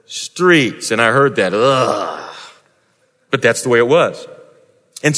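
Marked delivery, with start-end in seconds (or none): 1.5-2.4 low groan of disgust at the filth; a short low ugh of revulsion. Acted. Disgust at manure-filled streets.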